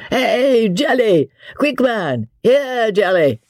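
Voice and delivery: high-pitched summoning call. A narrator's reading. Sir Percy summoning the landlord for a bowl, calling out.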